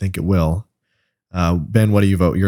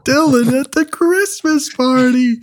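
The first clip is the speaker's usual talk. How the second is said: laughing. Real, not acted. Teasing about the Christmas photo.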